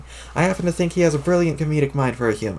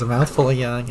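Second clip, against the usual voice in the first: ordinary delivery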